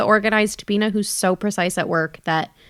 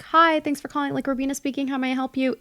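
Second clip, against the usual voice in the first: higher, softer polite phone greeting; pitched-up, professional call-answering voice; customer service voice; 5 semitones higher, 5 dB quieter than usual. Retold or imitated. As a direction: put on your sweet receptionist voice. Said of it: "customer service voice".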